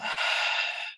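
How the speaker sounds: long, airy exhale; deep breath out. Shown as a demonstration. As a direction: model a relaxing breath for the class. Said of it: "Take a breath".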